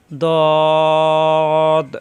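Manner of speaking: দদ said heavy from the tongue's side edge against the upper gums; thick, firm model দদ; Arabic letter dad. Shown as a demonstration. Teacher models heavy দদ for the class. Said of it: "দদের মাখরাজ জিহ্বার গোড়ার কিনারা".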